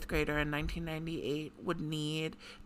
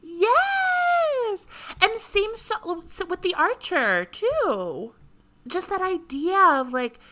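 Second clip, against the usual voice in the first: high, loud excited burst; 12 semitones higher, 8 dB louder, slower than usual. Real, not acted. Excited leap to a shared idea.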